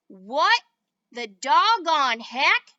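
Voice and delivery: loud stunned cowboy exclamation; astonished outburst. A narrator's reading. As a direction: blurt it out in loud disbelief.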